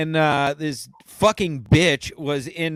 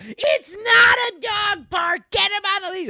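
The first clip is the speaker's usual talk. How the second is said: hammed-up shrill scolding; mock old woman shout. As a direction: shout it like the furious woman in the park. Quoting the angry woman.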